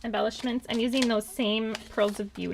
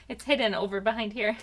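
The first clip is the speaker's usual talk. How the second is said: ordinary talk, nothing marked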